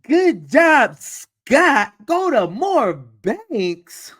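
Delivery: high, loud disbelief, dragging out the question; incredulous mockery. Real, not acted. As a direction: scoff at the advice in disbelief. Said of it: "mocking the experts' advice".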